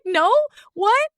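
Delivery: high, baffled no; shocked denial. Retold or imitated. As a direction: recoil in disbelief.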